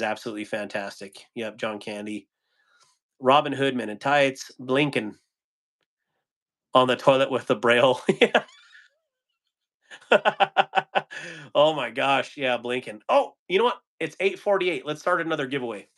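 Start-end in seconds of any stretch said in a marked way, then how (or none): none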